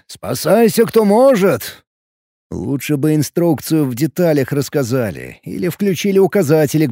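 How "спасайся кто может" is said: much higher, louder, slow alarmed cry; panicked shout. Pretend. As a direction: yell it like the ship is sinking. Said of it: "таким тоном оставалось только добавить".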